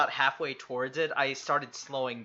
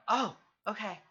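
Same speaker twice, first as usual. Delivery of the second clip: higher, awkward sheepish farewell; awkward Twilight; 8 semitones higher, faster than usual. Acted. Twilight finally getting the hint, higher awkward voice. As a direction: back off politely, a bit flustered. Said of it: "And I go".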